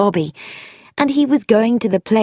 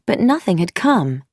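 ordinary delivery

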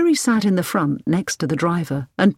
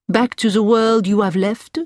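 higher-pitched Frenchman with a courteous question; French doctor; 4 semitones higher, 4 dB louder than usual. A narrator's reading. Doctor Barron asks Hilary about going back, French doctor voice.

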